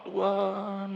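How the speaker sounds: long drawn-out plea to God; praying. Retold or imitated. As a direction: mimic someone calling out in prayer, stretched.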